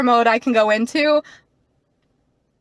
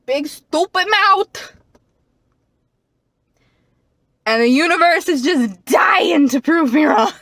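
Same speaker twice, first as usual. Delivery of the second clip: melodramatic; 6 semitones higher, 5 dB louder than usual. Pretend. Dramatic self-mocking complaint.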